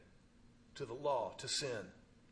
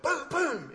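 mock thuds; 9 semitones higher, 5 dB louder than usual. Back hits the diving board.

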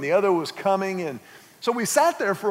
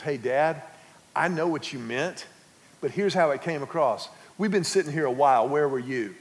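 a hint of a daughter, lower; 6 semitones lower than usual. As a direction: explain it to Dad gently. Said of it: "she goes".

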